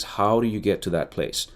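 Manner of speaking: ordinary delivery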